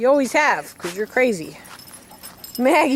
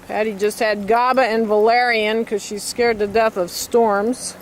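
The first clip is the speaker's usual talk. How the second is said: usual speaking voice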